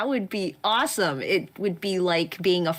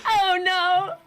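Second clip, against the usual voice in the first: higher, whimpering mock sob; mock crying; 11 semitones higher than usual. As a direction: wail it like a fake cry. Reading a panicked chat message.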